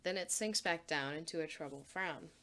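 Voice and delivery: ordinary delivery, nothing marked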